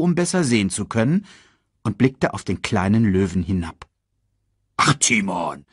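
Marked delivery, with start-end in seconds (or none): 4.8-5.6 lightly snorted dismissal. Acted. Warthog brushing off meerkat's alarm, low mild snort. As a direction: huff it low at your friend. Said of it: "schnaubte er".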